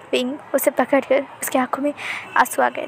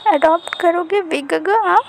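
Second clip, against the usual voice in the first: put-on hopeful child; 5 semitones higher, 4 dB louder than usual. A narrator's reading.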